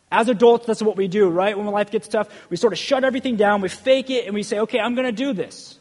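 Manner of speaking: high, loud sermon storytelling; impassioned preaching. Real, not acted. Preacher telling how adults fake it when life gets tough, impassioned.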